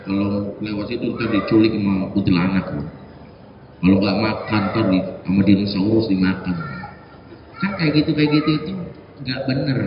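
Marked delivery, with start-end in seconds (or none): 0.1-6.6 low, hushed mock threat to a child; mock scary parent. Lightly voiced parent scaring a child. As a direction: parent scaring a kid so he eats.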